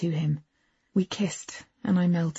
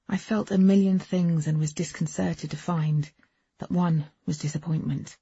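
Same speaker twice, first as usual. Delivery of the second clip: plain reading voice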